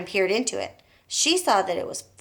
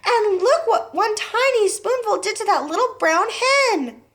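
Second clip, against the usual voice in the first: panicked wail; 12 semitones higher, 5 dB louder than usual. A narrator's reading. Panicked daughter.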